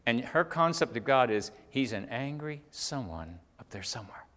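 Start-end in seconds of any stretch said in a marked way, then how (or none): 1.7-4.2 soft, subdued repeat of a quote; hushed and measured, for reflection; sober restatement. Retold or imitated. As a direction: let it sink in quietly. Preacher soberly restates her words.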